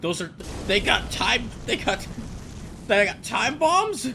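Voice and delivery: very high, loud, slowed disbelief; real astonished squeal; incredulous. Real, not acted. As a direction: stretch it out in amazed shock. Sees enemies with bombs.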